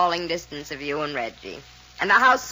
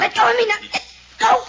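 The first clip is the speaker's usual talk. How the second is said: struggling to break free; 12 semitones higher, 6 dB louder, faster than usual. Acted. Woman struggling against a grip.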